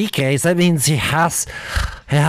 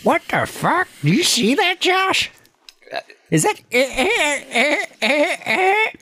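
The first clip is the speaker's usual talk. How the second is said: high, incredulous stammer; shocked disbelief; 11 semitones higher than usual. Real, not acted. Shocked at Josh's reaction.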